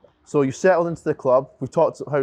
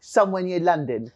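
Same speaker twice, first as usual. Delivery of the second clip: higher put-on London accent; mock London accent; 4 semitones higher than usual. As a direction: mimic his London accent to tease him. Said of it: "I don't sound like that though".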